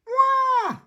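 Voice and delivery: put-on very high, loud toddler wail; mock crying wail. George and Richard wailing when made to wait, hammed-up cry. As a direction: wail like a little one denied a turn. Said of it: "they cry".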